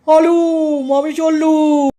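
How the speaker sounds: long, drawn-out tiger roar; hammed-up tiger bellow; roaring tiger shout. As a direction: roar out the words to raise the alarm. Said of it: "এমন হালুম করে চিৎকার করবো".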